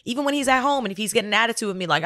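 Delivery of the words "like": usual speaking voice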